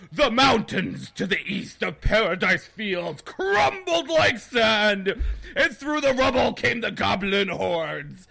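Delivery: booming, slow, high-pitched epic fantasy read; hammed-up dramatic audiobook reading; over-the-top narration. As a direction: proclaim it like an overblown movie trailer. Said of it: "take it down a few notches".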